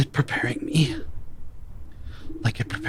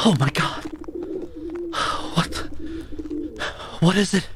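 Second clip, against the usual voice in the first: sharp, higher alarmed question; startled; 9 semitones higher, faster than usual. Acted. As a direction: jump at it in alarm. Alarmed by a sudden discovery.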